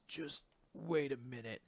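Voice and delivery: acted, very soft and halting; weak murmur. Battered Asta holding off pleading Mimosa, weak murmur. Battered hero answers his healer.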